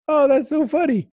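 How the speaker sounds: high mock-amused voice; lightly voiced amused famous author; amused imitation. Imitating Coelho's amused reply.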